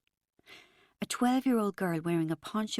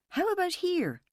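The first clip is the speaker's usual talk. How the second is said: hopeful suggestion; 12 semitones higher than usual. A narrator's reading. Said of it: "Chris suggested".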